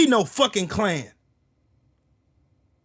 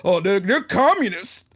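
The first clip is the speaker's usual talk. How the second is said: low, soft, mock smarmy voice; mocking politician; 12 semitones lower, 3 dB quieter than usual. Mocking Republicans who smile in voters' faces. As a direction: drone look look look like a smug politician. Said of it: "smile in your face".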